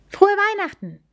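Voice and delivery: high, bright call of a greeting; gerufener Gruß. Acted. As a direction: call it out cheerfully across the path. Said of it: "rief sie und winkte". (fröhlich gerufen)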